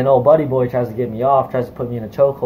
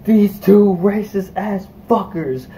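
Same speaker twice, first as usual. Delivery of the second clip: raised, higher-pitched retold rant, slowed at the start; retold screaming; 5 semitones higher than usual. Yelling at the conductors and bystanders, in a partly recreated, raised voice. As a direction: hammer the words out like the fight is still on. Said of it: "I was just started screaming at everybody".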